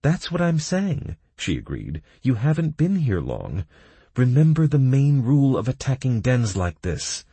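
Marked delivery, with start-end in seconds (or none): 0.0-1.2 higher agreeing female voice. A narrator's reading. Kira's voice, agreeing. 2.2-7.3 higher, louder instructive female. A narrator's reading. Kira instructs.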